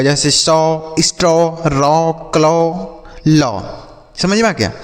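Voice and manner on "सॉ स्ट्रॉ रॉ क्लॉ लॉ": सॉ, स्ट्रॉ, रॉ, क्लॉ, लॉ each with "aw"; aw vowel. Shown as a demonstration. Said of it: "अ के रूप में होगा".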